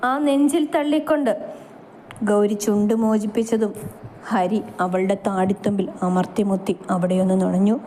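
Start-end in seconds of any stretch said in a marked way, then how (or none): none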